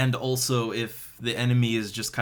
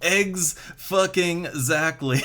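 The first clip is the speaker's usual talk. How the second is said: laughing; 3 semitones higher, slower than usual. Real, not acted. Laughing through the words.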